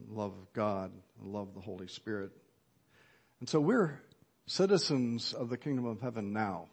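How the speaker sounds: ordinary delivery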